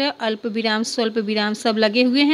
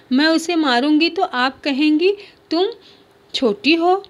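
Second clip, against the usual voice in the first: put-on higher, louder childish complaint; little girl complaining; 6 semitones higher, 4 dB louder than usual. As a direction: whine like a wronged little girl. (छोटी बच्ची की ऊँची शिकायत)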